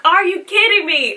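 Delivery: high, loud incredulous outburst; exasperated disbelief. Retold or imitated. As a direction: throw it back at her, can't believe it. Retelling reaction to doctor's question.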